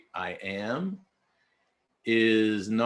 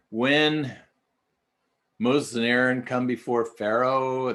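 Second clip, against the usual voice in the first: usual speaking voice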